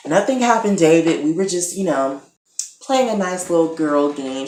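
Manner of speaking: mock-innocent excuse; feigned innocence. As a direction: cover up with a high, innocent voice. Acting Catherine feigning innocence.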